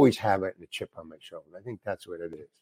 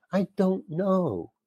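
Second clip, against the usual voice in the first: slow and quiet, higher, fed up; deadpan repeat; 5 semitones higher, 6 dB quieter, slower than usual. Retold or imitated. Actor repeating his answer to the director, slow and fed up. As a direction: say it again, tired shrug. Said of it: "And I just said it like that".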